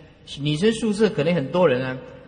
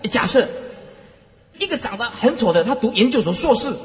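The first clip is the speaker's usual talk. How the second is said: ordinary delivery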